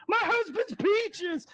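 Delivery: very high, drawn-out mock shriek; alarmed wife impression. Shown as a demonstration. Wife crying out over her grabbed husband, hammed-up falsetto shriek. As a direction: stretch it out in a piercing falsetto wail. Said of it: "my impression of that woman".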